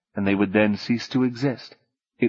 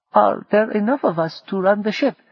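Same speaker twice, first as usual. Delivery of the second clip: put-on higher, lighter voice for the asking boy; a worried question from the young crewman; young Chuan Lee; 11 semitones higher than usual. Boy asks Queenie if they can sail, in a higher voice.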